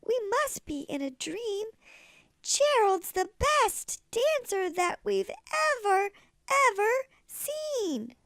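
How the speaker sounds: high, excited crowd cheering; amazed shout. Acted. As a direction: cheer in wonder. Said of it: "They shouted".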